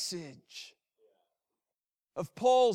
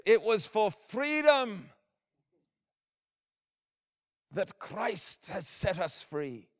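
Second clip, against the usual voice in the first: rousing proclamation; 5 semitones higher than usual. Retold or imitated. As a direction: proclaim it like rallying a crowd. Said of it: "what it would sound like when he declares".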